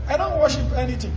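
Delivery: normal talking voice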